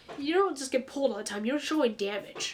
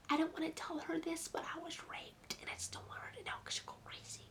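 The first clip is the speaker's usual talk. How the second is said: imitating an unbothered whisper; much softer, unvoiced and casual; flat whisper; 4 semitones higher, 14 dB quieter than usual. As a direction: whisper it like gossip, no emotion. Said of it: "She said it like that".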